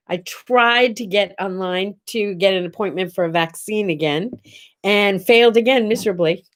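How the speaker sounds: ordinary delivery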